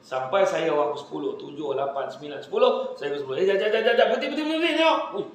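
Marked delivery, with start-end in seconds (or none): 3.4-5.2 high, louder hurried stop; loud high mock astonishment; excited friend. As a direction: call him to a halt in amazement. Tenth friend stopping him.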